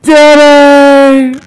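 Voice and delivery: put-on high, loud drawn-out kid's yell; child calling Daddy. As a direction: call out for Dad like a small child from upstairs. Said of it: "all of a sudden you hear".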